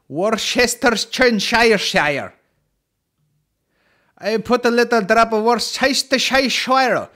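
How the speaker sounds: high, loud, drawn-out garbled sauce name; mock mispronouncing. Joker mangles the sauce name, mock mispronouncing. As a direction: mangle the words loudly in a high comic voice.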